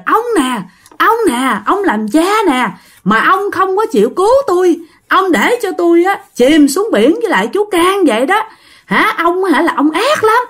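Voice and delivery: put-on high-pitched angry young man speaking through a woman; the drowned son's ghost, blaming his father through a possessed woman. Possessed son accusing father.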